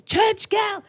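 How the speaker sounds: mock scream. Woman behind her at the mall, high mock scream. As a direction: mimic a high scream at moderate volume. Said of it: "And then she screamed".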